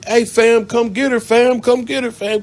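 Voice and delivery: high, loud urgent call; yelling. Retold or imitated. Stranger yelling at narrator to pull her off. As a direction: shout across the gas station for help. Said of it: "He yell at me".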